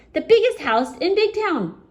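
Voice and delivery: high, loud climax on biggest; the grandest house, said high and loud; excited build. A narrator's reading. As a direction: lift it up high with wonder.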